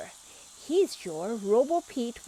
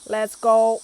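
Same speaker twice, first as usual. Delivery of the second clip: loud eager robot shout; 13 dB louder than usual. A narrator's reading. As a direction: blast it off like a launch. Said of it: "Robo Pete shouts".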